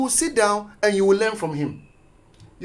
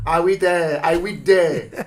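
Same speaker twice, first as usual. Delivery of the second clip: mock taunt; 5 dB louder, slower than usual. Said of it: "when people were passing by".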